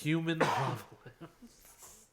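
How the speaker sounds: laughing through the words; amused, slow, laughing delivery. Real, not acted. Repeats joke laughing.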